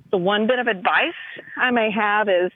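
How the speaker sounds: usual speaking voice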